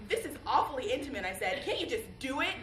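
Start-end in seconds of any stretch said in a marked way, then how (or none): 0.1-1.2 raised, louder, uncomfortable objection; embarrassed protest. Acted. Embarrassed protest at being looked at. 1.6-2.5 higher, louder impatient urging; exasperated plea. Acted. Impatient plea to lover to hurry.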